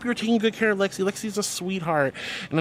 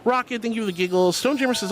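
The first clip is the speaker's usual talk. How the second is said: ordinary talk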